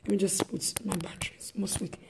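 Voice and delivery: quick whispered side remark; whispered aside. Real, not acted. Preacher mutters about her phone battery, whispered. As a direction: whisper it off-mic.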